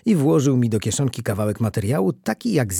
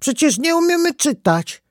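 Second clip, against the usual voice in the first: very high-pitched, indignant cry; exasperated kid's outcry; child's shouted protest; 4 dB louder than usual. A narrator's reading. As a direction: protest loudly like a little boy. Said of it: "krzyknął Staś".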